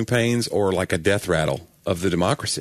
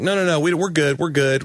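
mock dismissive denial; 7 semitones higher than usual. Mimicking a nation's denial.

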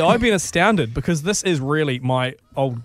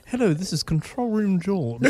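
put-on royal family English; posh English accent; 2 semitones higher, 3 dB quieter than usual. Asked to sound like the royal family, mock posh English accent. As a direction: play a posh British radio host. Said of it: "why don't you be more posh".

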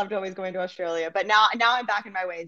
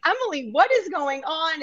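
mock motherly questioning, much higher and slow; exasperated mom, imitated; 8 semitones higher than usual. Mom questioning daughter's new Australian accent, imitated exasperation. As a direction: imitate a mom's bewildered question, pitched way up. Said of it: "my mom would be like".

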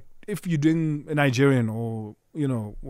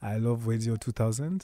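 Congolese accent; 4 semitones lower, 8 dB quieter than usual. Shown as a demonstration. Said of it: "Congolese accent".

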